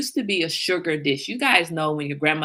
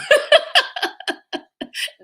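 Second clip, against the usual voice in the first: high cackling laugh; real high-pitched burst of laughing; laughter; 4 dB louder than usual. Real, not acted. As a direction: crack up at your own prank. Laughing at her own prank.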